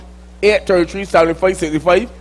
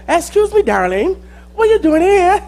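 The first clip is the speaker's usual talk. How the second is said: much higher, dainty mock voice; little small voice; 7 semitones higher than usual. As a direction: coo it out like a tiny sweet-talker. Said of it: "I hear this little small voice".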